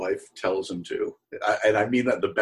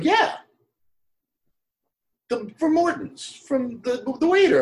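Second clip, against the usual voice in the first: much higher, eager, reminding him who you are; eager recognition; 4 dB louder than usual. Retold or imitated. Reminding Dan he was his waiter, high and eager. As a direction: pitch it up, excited to be recognised.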